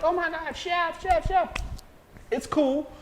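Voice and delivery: very high-pitched fawning mimicry; mock fawning. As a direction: squeal it lightly like a starstruck admirer. Mimics gushing people in his kitchen.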